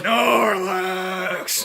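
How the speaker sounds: drawn-out mock monster cry; creature call. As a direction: call out the name like a big lumbering creature. Calls out creature name as impression.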